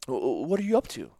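grasping for words to fill the silence; nervous stammer. Retold or imitated. Son fumbling for words with his dying mother, stammering. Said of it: "searching for something to say".

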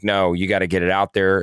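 usual speaking voice

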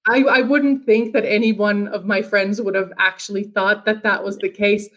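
ordinary delivery

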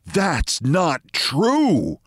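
slow, dignified denial; with dignity. Acted. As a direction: draw yourself up and deny it slowly. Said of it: "said Mister Preble with dignity".

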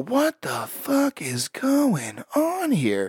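slow, high, stunned question; baffled disbelief. Retold or imitated. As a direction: drag it out in disbelief. Baffled at church scene.

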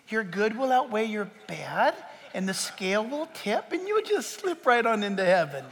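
normal talking voice

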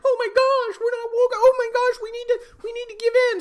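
high, loud mock panic, rushing; hammed-up high squeal, fast at the end. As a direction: squeal it like a frantic liberal. Mocking panicking liberals.

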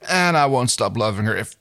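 impassioned, hurting character voice; anguished confession. A narrator's reading. Crichton confessing his love to Dargo, anguished. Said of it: "he broke off and turned away".

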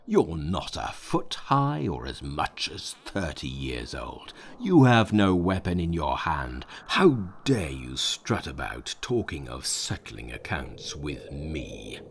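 low, slow mocking demon; a deep sneering monster. A narrator's reading. Demon mocks Monkey.

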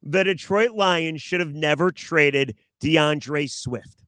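slow, deliberate statement. Real, not acted. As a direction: say it slowly, spelling out the point. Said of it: "I'll say it slower".

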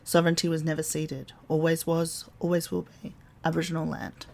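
measured, even acknowledgement of country; solemn scripted statement; formal acknowledgement reading. Acted.

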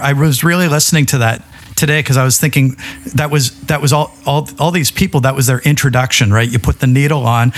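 ordinary talk

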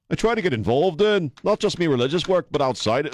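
high-pitched, hammed-up Liverpool accent; terrible Liverpudlian accent. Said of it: "the worst Liverpudlian accent I've ever done".

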